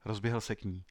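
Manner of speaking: plain reading voice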